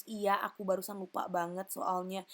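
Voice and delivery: normal talking voice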